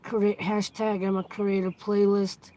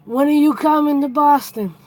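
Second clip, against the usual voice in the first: louder, higher Boston accent question; 4 semitones higher, 6 dB louder than usual. Shown as a demonstration. Asking a viewer in a Boston accent. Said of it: "I'll say it with the Boston accent".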